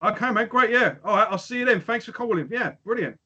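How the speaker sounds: high, bright forced politeness over excitement; mock calm excitement. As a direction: keep it together on the phone, voice high with excitement. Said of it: "wrote down".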